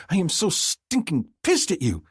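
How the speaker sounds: high indignant female voice; furious woman. A narrator's reading. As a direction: hurl it at him in outrage. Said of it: "she bellows in my ear".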